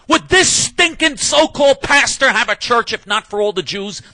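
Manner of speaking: angry radio host erupting; furious shout. Real, not acted. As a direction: blast it out in outrage. Furious rant at pastor.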